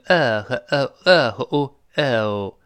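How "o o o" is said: British "o" drawn out, eh sliding to oo; slow British "o" with both halves distinct; British diphthong. Shown as a demonstration. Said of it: "这个双元音分得特别清".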